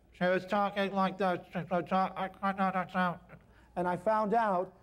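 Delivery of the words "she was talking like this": imitating a stuffed-up nose, sealed sinuses; blocked-nose voice. Imitating the patient with sealed sinuses, stuffed-nose voice. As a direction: talk as if the nose is fully blocked.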